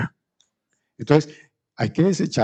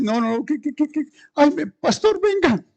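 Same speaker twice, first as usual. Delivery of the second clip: rising, frantic squeaks; panicky high pleading; 13 semitones higher than usual. Acted. Scared believer calling the pastor, high mock panic. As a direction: scramble for help in mock panic.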